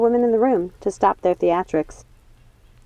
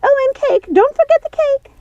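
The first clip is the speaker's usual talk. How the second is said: much higher, louder call; Martha calling out; 6 dB louder than usual. Acted. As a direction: call out for cake, giddy. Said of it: "called Martha".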